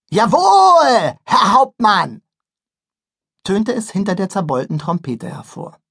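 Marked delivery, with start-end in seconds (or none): 0.1-2.2 military reply. Acted. Boy replies like soldier.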